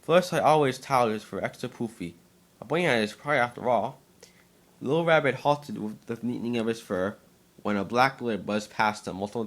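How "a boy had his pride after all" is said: ordinary delivery, nothing marked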